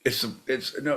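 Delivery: normal talking voice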